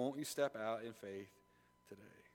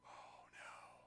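whispered dismay; the woman realizing she's found out, whispered; frightened whisper; 21 dB quieter than usual. Acted. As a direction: breathe it out in dread. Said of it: "the woman caught".

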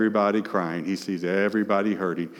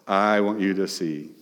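ordinary talk

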